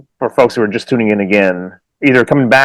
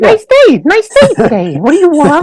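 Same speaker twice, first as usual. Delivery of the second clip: much higher, chirpy greeting to a stranger on the trail; overly friendly greeting; 4 dB louder than usual. Retold or imitated. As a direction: sing out hello to a passing hiker. Imitating trail greetings and reply.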